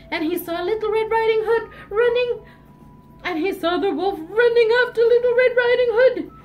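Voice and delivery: high, urgent storytelling; alarmed storyteller; excited chase narration. A narrator's reading. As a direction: raise the pitch as the danger peaks.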